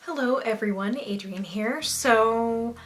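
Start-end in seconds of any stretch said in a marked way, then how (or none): none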